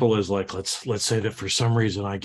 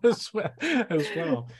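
laughing; 7 semitones higher, slower than usual. Real, not acted. Trying out a voice, laughing.

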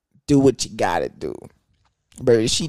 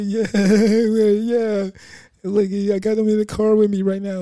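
mock eager boyfriend; 9 semitones higher, 3 dB louder than usual.

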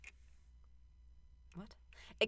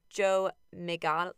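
normal talking voice

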